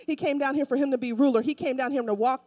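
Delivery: ordinary talk, nothing marked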